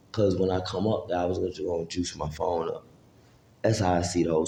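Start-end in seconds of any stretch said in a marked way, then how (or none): none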